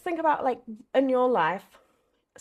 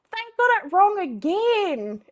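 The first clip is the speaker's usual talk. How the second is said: higher, louder and slow, fed up; exasperated outburst; 11 semitones higher, 7 dB louder than usual. Retold or imitated. Mom tells her son the order is wrong, exasperated. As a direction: groan it out to the kid in despair. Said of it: "I said".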